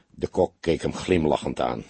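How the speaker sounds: ordinary delivery, nothing marked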